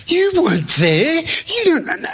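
high-pitched, mock-indignant sputter; exasperated retort. Preacher silently retorts to Jane's question, mock exasperated. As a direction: protest in a squeaky, hammed-up whine.

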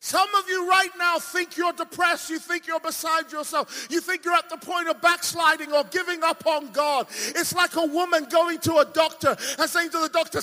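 high-energy, forceful sermon delivery; impassioned preaching. Real, not acted. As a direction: drive the testimony home to the crowd.

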